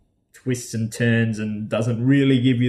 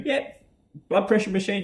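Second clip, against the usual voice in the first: cheery friend; 9 semitones higher than usual. Retold or imitated. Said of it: "helped me along the way going".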